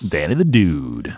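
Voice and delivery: low, slow dude announcement; hammed-up dude title. Host reads dude shout-out, low slow mock announcement. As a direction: stretch it out low and slowly.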